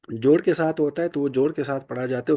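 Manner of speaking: normal talking voice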